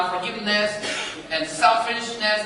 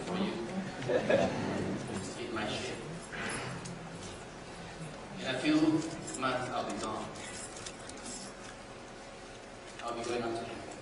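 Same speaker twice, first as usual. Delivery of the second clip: soft farewell; 8 semitones lower, 14 dB quieter, faster than usual. Acted. Voicing Jesus' farewell.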